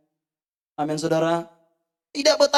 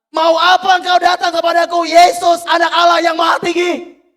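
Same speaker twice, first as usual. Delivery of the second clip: full-voiced demon shout, high and loud; 6 semitones higher, 8 dB louder than usual. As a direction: hurl the question at Jesus. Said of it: "dan berkata dengan keras".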